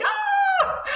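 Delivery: sudden high, loud shriek of shock. Retold or imitated. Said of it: "And I was just like".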